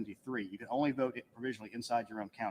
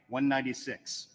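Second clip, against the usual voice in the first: usual speaking voice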